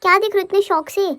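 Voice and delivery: put-on cute babyish voice, speaking as the toddler. Mother narrating as her baby to viewers, in a cute baby voice. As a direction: voice the baby's thoughts, playful and sweet.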